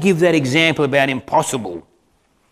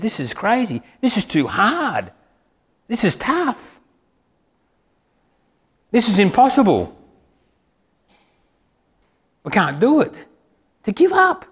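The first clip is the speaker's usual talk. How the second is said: disbelief; 6 semitones higher than usual. Acted. Disciples protesting it's impossible.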